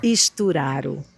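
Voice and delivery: slow, careful try at saying "Sturaro"; careful name repetition. Shown as a demonstration. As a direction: repeat "Sturaro" slowly to get it right. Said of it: "como é mesmo a pronúncia do seu sobrenome".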